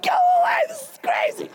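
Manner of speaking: very high, loud fed-up shout; king shouting Balaam away; exasperated yell. Retold or imitated. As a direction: throw him out in frustration. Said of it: "the king's like".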